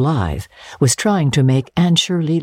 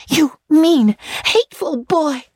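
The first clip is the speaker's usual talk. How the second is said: put-on high, furious girl's cry; girl lashing out at the teasing boy; passionate outburst; 14 semitones higher than usual. A narrator's reading. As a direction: fling it at him in outrage. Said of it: "she exclaimed passionately".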